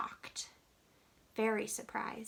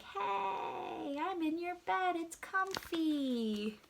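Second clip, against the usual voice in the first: higher, drawn-out cosy voice; happy gorilla; 6 semitones higher, slower than usual. Acted. Happy gorilla to the wife from her bed, higher contented voice. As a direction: stretch the words out, pleased and comfy. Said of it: "That gorilla looks pretty happy".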